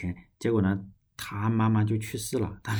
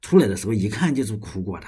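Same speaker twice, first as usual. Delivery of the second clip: ordinary talk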